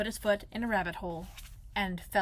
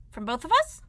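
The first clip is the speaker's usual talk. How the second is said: high, louder Piglet voice, rising question; hopeful Piglet; 8 semitones higher, 8 dB louder than usual. Acted. Piglet asks Pooh about sharing, high and eager.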